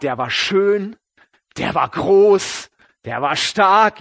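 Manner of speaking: mock-awed list, swelling in volume; admiring build-up. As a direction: relish the hero's looks, punching each adjective. Admiring build-up describing impressive eldest son.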